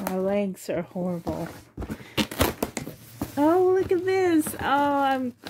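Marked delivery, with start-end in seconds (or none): 3.4-5.3 high, loud delighted gasp; thrilled squeal at a gift. Real, not acted. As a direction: burst out with real delight. Delighted opening gift box.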